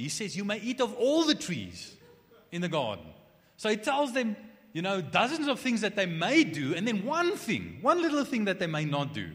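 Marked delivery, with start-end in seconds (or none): 0.4-3.1 loud, higher preaching emphasis on all the trees; emphatic may eat. Acted. Preacher stressing God's generous permission, loud and high. 3.6-9.3 loud, high, driving preacher voice; preaching at full force. Real, not acted. Preacher stressing the one forbidden thing, loud and high.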